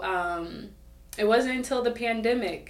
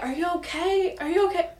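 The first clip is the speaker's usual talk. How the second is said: worried friend; 11 semitones higher, faster than usual. Retold or imitated. Friends checking on her at party.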